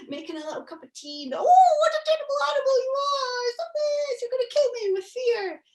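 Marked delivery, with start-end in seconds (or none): 1.3-5.6 much higher hammed-up squeal of fake fright; mock terror. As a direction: pretend to be terrified, overplayed. Granny fakes terror at the monster.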